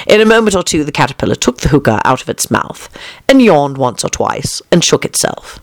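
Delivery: plain reading voice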